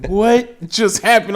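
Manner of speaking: high, slow puzzled question; retold confusion. Host retelling his confusion at the call, slow surprised high. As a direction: act baffled by the call. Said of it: "I was like".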